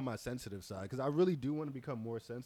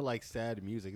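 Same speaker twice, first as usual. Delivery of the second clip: usual speaking voice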